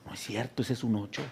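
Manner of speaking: soft muttered disagreement to his son; father quietly contradicting the doctor; quiet aside. Retold or imitated. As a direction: murmur it under your breath. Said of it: "yo estoy en un lado como".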